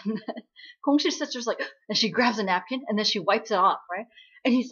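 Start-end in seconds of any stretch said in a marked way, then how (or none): none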